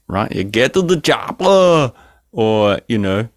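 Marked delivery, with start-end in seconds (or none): 0.3-1.9 slow, lightly Austrian action-hero line; mock Austrian accent, slow; Arnie's accent. Shown as a demonstration. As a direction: drag it out like Schwarzenegger. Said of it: "put on Arnie's accent".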